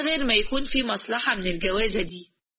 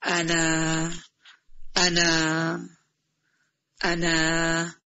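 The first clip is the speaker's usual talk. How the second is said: halting, lower, a shy suitor stalling; shy hesitation; 4 semitones lower, much slower than usual. Acted. Zizo stalling before proposing to Shams, shyly halting. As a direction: stammer it out, too shy to ask. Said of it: "أنت مكسوف مني ولا إيه".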